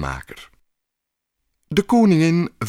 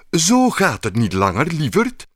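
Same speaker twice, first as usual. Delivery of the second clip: stern; 7 semitones higher, 3 dB louder than usual. Acted. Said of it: "zei ze streng".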